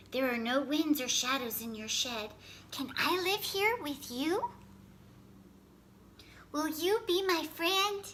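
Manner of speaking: put-on pleading, eager young animal; little fox voice. Fox asks to stay.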